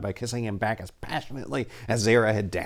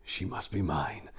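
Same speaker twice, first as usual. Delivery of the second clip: whispered; 2 semitones lower, 10 dB quieter, slower than usual. A narrator's reading. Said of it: "whispered the Ophirian prince".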